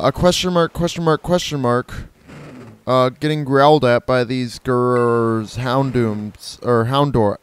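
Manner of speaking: ordinary talk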